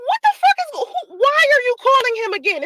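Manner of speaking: high, laughing disbelief; incredulous laughing confrontation. Retold or imitated. Confronting the side chick on the phone, laughing in disbelief. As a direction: laugh through the outrage.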